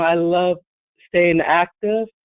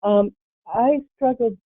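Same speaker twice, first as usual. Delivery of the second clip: ordinary talk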